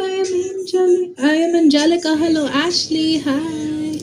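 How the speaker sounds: ordinary talk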